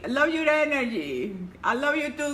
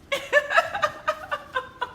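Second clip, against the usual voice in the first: a short, high-pitched real giggle; bright burst of laughter; 13 semitones higher than usual. Real, not acted. Laughing at her own joke.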